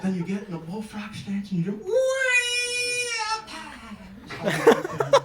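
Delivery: high squealing wee up high; mock jump squeal. Comic imitating the trainer's demo, squealing high.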